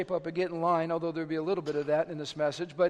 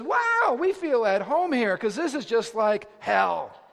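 delighted devil; 3 semitones higher, 5 dB louder, slower than usual. Acted. Devil marvels at the chaotic house, in mock delight. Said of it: "And the devil says".

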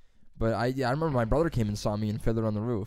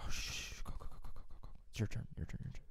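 urgent whispered hushing; backstage whisper; 10 dB quieter, much faster than usual. Retold or imitated. Stagehand hurrying actors on, in a whisper. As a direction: whisper them on fast, backstage.

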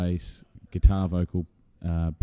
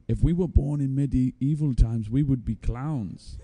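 slow, high mock Swedish drawl; badly attempted Swedish drummer, drawn out and higher; attempted Swedish accent; 7 semitones higher, 3 dB louder, slower than usual. Swedish drummer musing during a wedding lull, mock Swedish accent. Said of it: "I can't do a Swedish accent".

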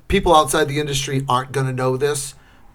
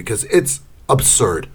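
ordinary delivery

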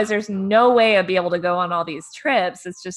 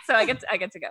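ordinary delivery

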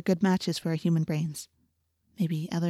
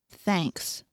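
grumpy; 4 dB quieter than usual. A narrator's reading. Tired girl answers Jake on the bus, mildly grumpy. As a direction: grumble it a little. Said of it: "I said grumpily".

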